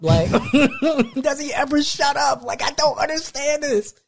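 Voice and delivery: high, laughing "does he ever shut up"; disbelieving, laughing at a chatty kid; laughing disbelief. Retold or imitated. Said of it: "And I'm like".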